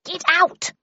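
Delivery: sharp high shout; yell. A narrator's reading. Mum raises the broom and shouts at the mammoth. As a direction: drive the animal out with your voice. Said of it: "yelled my mother".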